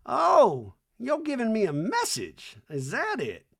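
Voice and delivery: high, loud mock Reba; hammed-up high Reba voice; Reba impression. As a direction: play Reba, suspicious and high. Voicing Reba's reply.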